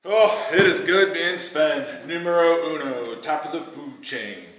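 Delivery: loud, slow, smug boasting; puffed-up star athlete showing off; cocky bragging. Acted. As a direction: gloat like the king of the team. Sven bragging in flashback.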